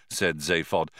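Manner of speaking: ordinary delivery, nothing marked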